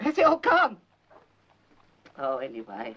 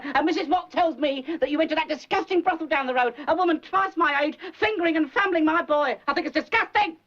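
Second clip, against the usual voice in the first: outraged scolding; 7 semitones higher than usual. Acted. Mother scolding son, outraged.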